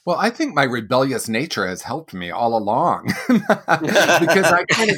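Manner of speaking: talking through real laughter, pitch up; laughing while talking. Real, not acted. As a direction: chuckle your way through the admission. Laughs at own rebelliousness.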